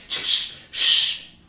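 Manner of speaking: short voiceless "ch" bursts; quick "ch-ch" attention noise; imitated summoning hiss. Shown as a demonstration. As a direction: hiss sharply as if summoning someone. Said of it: "eso lo de ch".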